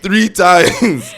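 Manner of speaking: laughing, shouted high and loud. Shown as a demonstration. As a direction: yell it out while laughing. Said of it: "How many times did I say it".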